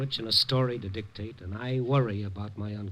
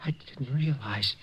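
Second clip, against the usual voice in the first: stunned whisper; 5 dB quieter than usual. Acted. Gunman stunned seeing her face.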